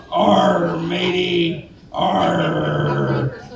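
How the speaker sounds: a lightly voiced pirate arr; pirate growl. Drugged roommate thinking he's a pirate, mock pirate arr. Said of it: "their growls turned into R's".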